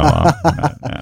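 ordinary delivery

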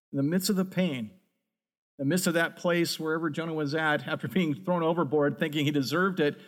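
ordinary talk, nothing marked

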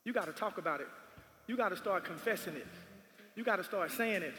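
soft, low preacher's counsel; hushed instruction. Real, not acted. Preacher giving the congregation practical steps, hushed and low. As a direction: drop right down and teach it quietly.

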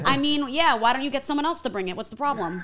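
loud, high Long Island attitude; sassy Long Island shrug-off; Long Island accent. Pretend. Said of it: "She killed it".

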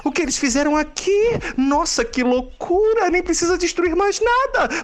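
high shrieking amazement; scandalized diva squeal; shocked gasp. Acted. Drag queen shocked arrival.

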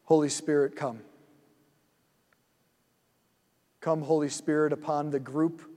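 soft, slow invocation, hushed toward the end; quiet prayer. Acted. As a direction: call the Spirit in gently, like a whispered prayer. Invokes the Holy Spirit.

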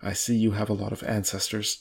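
plain reading voice

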